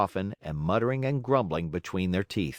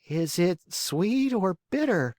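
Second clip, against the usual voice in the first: put-on high, slow whiny puppet boy; whining; 10 semitones higher than usual. A narrator's reading. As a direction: whine it like a spoiled child. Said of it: "asked in a whining voice".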